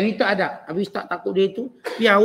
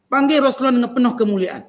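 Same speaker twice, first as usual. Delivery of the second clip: normal talking voice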